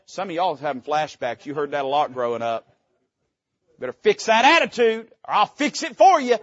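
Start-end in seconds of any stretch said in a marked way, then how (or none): 3.8-6.4 loud, high parental threat; parent's threat. Retold or imitated. A preacher mimicking a parent warning the congregation, loud and stern. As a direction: bark it like a fed-up parent.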